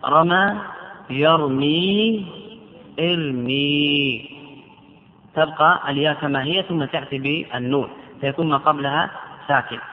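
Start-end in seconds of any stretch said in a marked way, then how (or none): 0.0-4.3 يرمي and ارمي stretched very slowly; slow drawn-out demo. Shown as a demonstration. Teacher showing the ياء stays, slowly. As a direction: hold each syllable of يرمي ارمي. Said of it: "لام الكلمة،".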